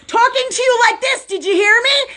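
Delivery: imitating a very loud, high-pitched talker; hammed-up high yelling impression; mock loud talker. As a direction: blare it out high, mimicking a friend who talks too loud. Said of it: "she used to speak really loud".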